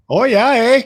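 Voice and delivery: high, loud hammed-up Canadian "Oh, yeah, eh?"; mock Canadian. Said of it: "Canada, eh?".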